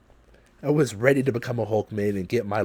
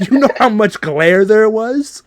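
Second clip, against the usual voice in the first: higher and louder, pushing the point; louder indignant repeat; 11 semitones higher, 11 dB louder than usual. Real, not acted. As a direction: repeat it with more outrage. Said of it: "You know how much glare there was in my glass?".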